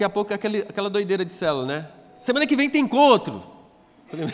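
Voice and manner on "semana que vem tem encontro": high, loud eager church-lady invite; loud happy invitation; excited invite. Retold or imitated. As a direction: sing it out like an eager church member.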